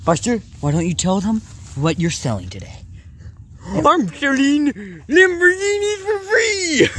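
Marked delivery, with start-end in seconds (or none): 3.6-6.9 laughing shout. Pretend. Dog's sales pitch, laughing.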